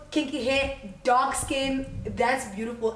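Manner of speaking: ordinary talk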